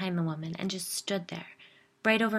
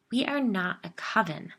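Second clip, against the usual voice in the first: ordinary delivery, nothing marked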